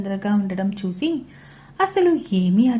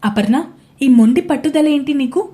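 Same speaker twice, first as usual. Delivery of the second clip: barely controlled anger; 4 semitones higher, 6 dB louder than usual. A narrator's reading. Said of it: "శాంతంగా చెప్పడానికి try చేశాడు".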